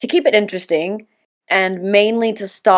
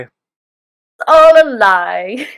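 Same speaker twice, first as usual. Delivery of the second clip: slow, louder, stretched out in mock drama; mock dramatic echo; 3 semitones higher, 7 dB louder, much slower than usual. Host echoing the co-host's line in slow mock drama. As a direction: echo it grandly and slowly.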